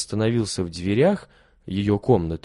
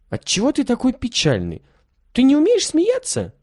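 put-on much higher, childlike girl's voice; curious little girl; 3 dB louder than usual. A narrator's reading. Lilya asks the sad hunchbacked boy, in a high childlike voice. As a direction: ask gently in a small girl's voice.

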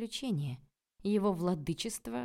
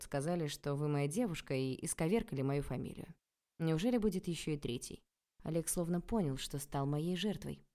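plain reading voice

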